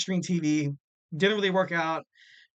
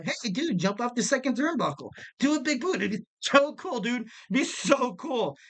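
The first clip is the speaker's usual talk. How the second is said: mock-excited pitch, much higher voice; hyped-up egging on; 7 semitones higher than usual. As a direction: hype it up like a clueless manager imitation. Acting out management egging wrestler on.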